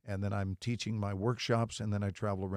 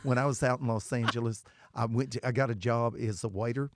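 Tennessee friend impression; 3 semitones higher than usual. Actor impersonates his Tennessee friend's complaint, Tennessee accent. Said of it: "based on a friend of mine who lives in Knoxville".

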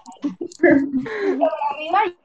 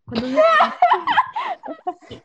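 much higher and slow, half-laughing; teacher's fussy finger test, pitched way up; mock teacher; much slower than usual. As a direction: drag "¿Cuántos dedos tengo?" out high and slow. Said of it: "viene y me dice".

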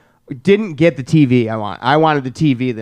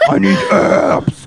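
put-on zombie kid voice; 6 semitones lower, much slower than usual. S0 mimics kid, low.

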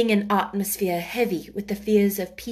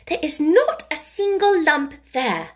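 high, loud, firm verdict; cross child; 7 semitones higher, 5 dB louder than usual. A narrator's reading. Mary gives her verdict on his back, crossly. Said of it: "those crossly spoken childish words".

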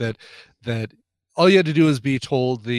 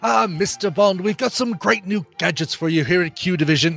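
lightly raised, fussy quartermaster voice; a British quartermaster showing off gadgets; John Cleese as Q; 4 semitones higher than usual. Acted. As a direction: play the prim gadget-master briefing Bond. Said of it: "Yes, I'm doing the John Cleese".

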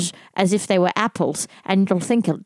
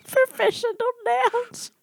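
laughing. Real, not acted. Laughing repeat of the phrase.

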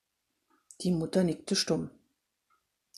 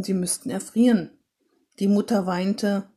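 ordinary delivery, nothing marked

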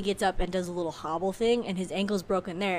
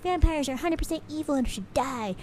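higher-pitched mock zealot; imitating fanatics condemning with conviction; church radicals; 7 semitones higher than usual. Church radicals denouncing vampires, mock zealous. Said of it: "radicals of the church are like".

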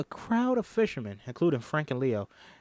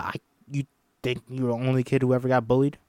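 usual speaking voice

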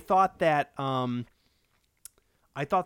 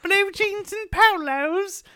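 high, loud mock little-kid voice; four-year-old boy; 8 dB louder, slower than usual. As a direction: answer Mummy as an obedient small child. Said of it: "like, a four-year-old Kyle".

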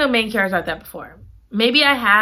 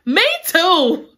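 excited gushing; 9 semitones higher, slower than usual. Retold or imitated. Reader answering the heroine, squealing with excitement. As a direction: gush over the date like a giddy friend. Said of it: "I said".

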